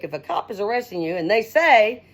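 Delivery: usual speaking voice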